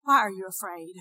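totally unbothered, voice dropped; calm. Acted. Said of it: "He's calm".

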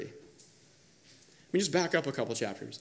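normal talking voice

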